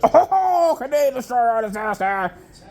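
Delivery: high, laughing mock voice; mocking the commentator. Imitating the laughing commentator, high and giggly. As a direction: giggle it out like a clueless fan.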